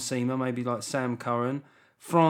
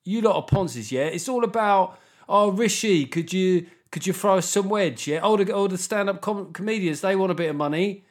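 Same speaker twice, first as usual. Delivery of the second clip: loud, raised-pitch mock outburst; acted angry rant; 6 semitones higher, 4 dB louder than usual. Rishi imagined finally turning on the comedians who want money, mock angry rant. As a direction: rant at the lot of them in mock outrage. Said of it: "he'll eventually go".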